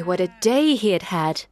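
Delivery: ordinary delivery, nothing marked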